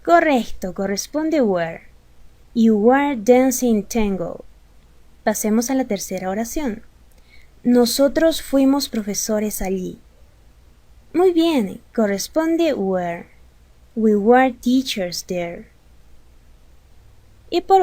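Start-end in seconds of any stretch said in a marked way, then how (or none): 2.5-4.5 each word spelled out slowly for learners; slow model sentence. Shown as a demonstration. Teacher modeling an English sentence for Spanish speakers, slow and clear. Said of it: "teacher models English sentence". 14.0-15.7 each word spelled out slowly for learners; slow model sentence. Shown as a demonstration. Teacher modeling an English sentence for Spanish speakers, slow and clear. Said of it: "teacher models English sentence".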